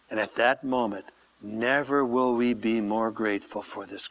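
low, soft, intimate prayer; hushed prayer. Real, not acted. Pastor closing in prayer before communion, low hushed reverence. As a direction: pray quietly, sinking at times into whisper.

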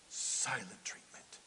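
low, drawn-out whisper; very soft and slow, almost no voice; hushed silent treatment. Shown as a demonstration. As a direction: say it slowly under your breath.

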